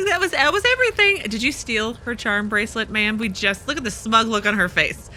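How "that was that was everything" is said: lightly mimicking the landlady's higher pitch; mock landlady's high-pitched voice; high-pitched imitation. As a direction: pitch it up like her squeaky answer. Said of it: "had that, like, high-pitched sound again".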